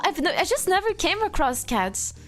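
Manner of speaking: normal talking voice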